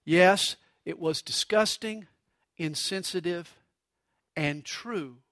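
low, soft, paused agreement; measured reply. Retold or imitated. Wife calmly answering her angry husband, quiet and measured. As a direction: land each word quietly, sinking to the last. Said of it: "Missus Willimon replied".

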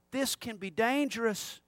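normal talking voice

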